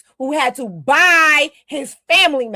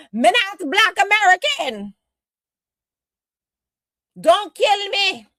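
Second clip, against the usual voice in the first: mock thick foreign accent, higher and pleading, with "Don't kill me" slowed right down; imitating a scared woman hamming up her accent for the police; 8 semitones higher than usual. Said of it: "She made sure to put her accent on super thick".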